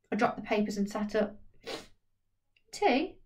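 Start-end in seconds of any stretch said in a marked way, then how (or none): 2.7-3.2 put-on old woman asking. Old woman offering tea.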